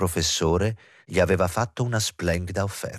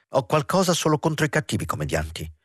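smiling retort; 6 semitones higher than usual. Acted.

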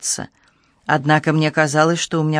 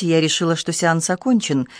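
usual speaking voice

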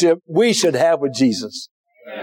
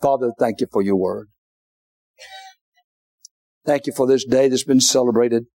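prayer; 4 semitones lower than usual. Acted. Preacher praying over the congregation, low gentle prayer. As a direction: pray gently over the room. Closing prayer and altar call.

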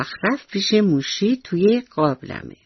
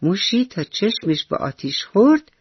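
ordinary delivery, nothing marked

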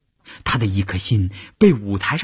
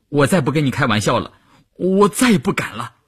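weak-kneed pleading; 5 semitones higher than usual. A narrator's reading. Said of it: "双腿发软地说".

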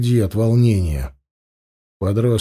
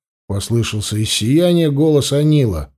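usual speaking voice

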